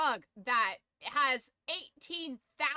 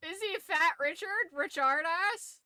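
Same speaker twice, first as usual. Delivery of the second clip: loud and much higher, shocked disbelief; incredulous; 9 semitones higher, 9 dB louder than usual. Real, not acted. Reacting to a nickname list, loud incredulous disbelief. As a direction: blurt it out in disbelief.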